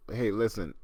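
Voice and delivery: ordinary talk, nothing marked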